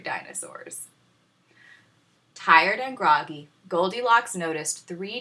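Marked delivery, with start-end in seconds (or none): 0.0-0.9 1 ominous whisper. A narrator's reading. Ominous aside.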